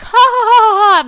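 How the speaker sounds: high, loud jeering ha ha ha; mocking laughter. Vlogger imitating the attackers' jeering laugh. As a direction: mimic thugs laughing as they kick him. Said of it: "they're just like".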